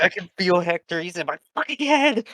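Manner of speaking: hammed-up frantic outburst, climbing in pitch; feigned breakdown. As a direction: cry out as if something has taken over. Acts possessed episode for prank.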